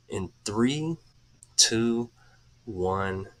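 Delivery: slow, soft breathing-coach voice with airy exhales; guided breathing. Acted. Coach leading viewers through deep breaths, slow and soft. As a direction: ease the listeners through each breath, unhurried. Leads second breathing round.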